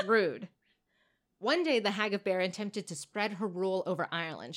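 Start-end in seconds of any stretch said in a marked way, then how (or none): none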